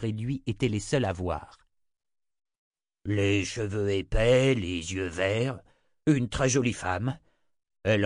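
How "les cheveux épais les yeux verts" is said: slow leering innkeeper. Acted. Innkeeper leers, describing woman.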